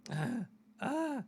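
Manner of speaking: rough cough into a squeak. Real, not acted. Host coughing on air, voice cracking. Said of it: "starting to sound like Fozzie Bear".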